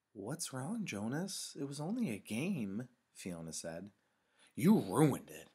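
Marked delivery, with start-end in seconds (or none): 0.1-2.9 put-on high, swooping girl's voice; Fiona's concerned questioning. A narrator's reading. Girl questions her upset friend, high lilting voice. 4.6-5.5 loud, accusing outburst; irritated. A narrator's reading. Boy blames his friend for spoiling the game, irritated. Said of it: "said in an irritated voice".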